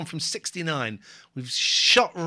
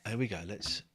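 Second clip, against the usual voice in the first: whispering; 7 semitones lower, 9 dB quieter than usual. Real, not acted. Whispers along.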